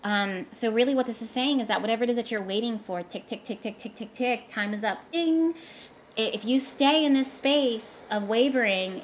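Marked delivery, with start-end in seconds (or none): 3.0-4.1 soft ticking clock. Pretend. Imitating a clock running out. 5.1-5.6 timer ding. Pretend. Timer going off.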